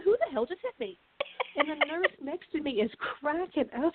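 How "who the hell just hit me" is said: startled. Retold or imitated. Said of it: "I looked around and I said".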